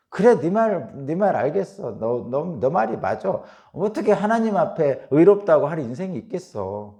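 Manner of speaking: loud, high-pitched exasperated concession; retold lament. Preacher voicing Job answering his friend, loud retold lament. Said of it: "한탄하는 거예요".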